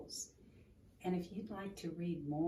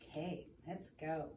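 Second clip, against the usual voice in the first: ordinary delivery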